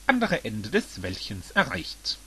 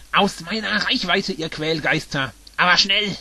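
loud, raised villain's shout; 5 semitones higher, 5 dB louder than usual. A narrator's reading. Bowser chases the pests away from himself, shouting. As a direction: order them off, building to a yell. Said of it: "schrie Bowser".

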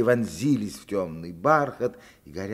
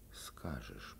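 whisper; 11 semitones lower, 17 dB quieter than usual. Acted. Hushed question to the girl.

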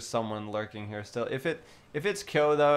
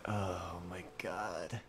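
drawn-out whispered disbelief; hushed mock dismay; 7 semitones lower, 12 dB quieter, much slower than usual. Streamer imitates acquaintances watching the stream, hushed mock dismay. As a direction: whisper it low and slow, as an appalled onlooker. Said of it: "probably being like".

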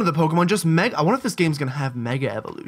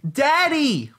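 put-on child voice; 10 semitones higher than usual. Child calling out in story.

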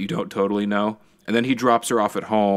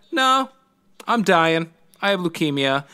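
put-on tragic girl; 8 semitones higher than usual. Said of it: "She's like".

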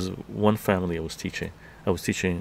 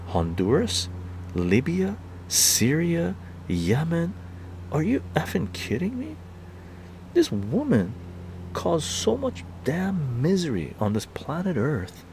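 higher-pitched, emphatic outrage; exasperated rant; 7 semitones higher than usual. Real, not acted. Ranting about the misery Clinton caused, exasperated. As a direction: pile it up in real disbelief. Worked-up rant listing wars.

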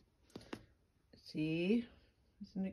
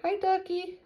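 much higher cooed hi; baby talk greeting; 11 semitones higher than usual. Pretend. Mother greets toy duck for baby, high coo.

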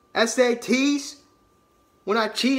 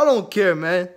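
usual speaking voice

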